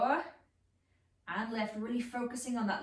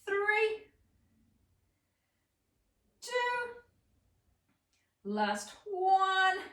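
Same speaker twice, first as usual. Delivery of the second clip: higher, strained counting; counting through trembling muscles; shaking with effort; 7 semitones higher, much slower than usual. Real, not acted. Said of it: "I'm shaking".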